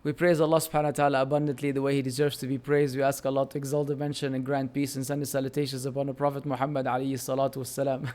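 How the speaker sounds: rapid, low, soft recitation; opening invocation. Real, not acted. Speaker opens his talk with praise, rapid low recitation. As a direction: rattle off the formulaic praise in a low monotone.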